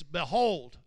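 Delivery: "Behold" punched out loud with a falling end; emphatic BEHOLD. Shown as a demonstration. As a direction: lean hard on "Behold". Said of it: "I'm putting emphasis on what I'm saying".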